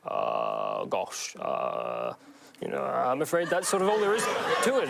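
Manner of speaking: hesitant, apologetic Hugh Grant fumbling; Hugh Grant impression. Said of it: "you did a Hugh Grant impression".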